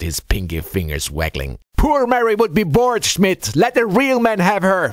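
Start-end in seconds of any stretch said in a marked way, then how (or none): 1.8-4.9 loud, high-pitched mocking banter; laughing taunt. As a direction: tease your mate loudly, voice way up. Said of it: "Franz had laughed".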